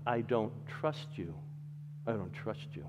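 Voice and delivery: quiet refusal. Retold or imitated. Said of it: "That's what he says".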